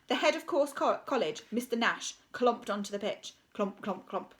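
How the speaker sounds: ordinary delivery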